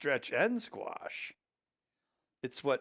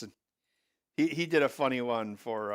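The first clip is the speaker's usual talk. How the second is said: usual speaking voice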